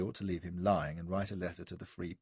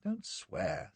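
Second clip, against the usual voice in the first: high, lightly chiding; tut-tutting, in a raised pitch; mild reproof; 13 semitones higher, 4 dB quieter than usual. A narrator's reading. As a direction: chide a fussing patient. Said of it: "he clicked his tongue reprovingly".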